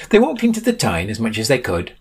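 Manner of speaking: usual speaking voice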